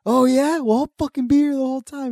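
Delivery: high, loud, defiant retort; imitated yelling. Dad shouting at the neighbour across the street, high and loud. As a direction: yell it back at the neighbour. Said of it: "he's yelling this shit".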